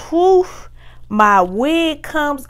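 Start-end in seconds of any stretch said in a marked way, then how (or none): 0.1-0.5 startled high whew. Retold or imitated. Pulling the dress off, a startled whew. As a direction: let out a quick high whew.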